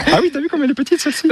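high-pitched excited cooing; gushing, besotted. Real, not acted. Marvelling at a tiny bee on the ivy, gushing. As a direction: get carried away over something tiny. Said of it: "on s'emballe un peu, on est gaga".

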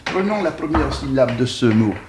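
normal talking voice

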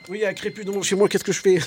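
quick, high voice of a worried caller; mock caller voice. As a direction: play a panicky resident on the phone. Mocking a resident calling the militia.

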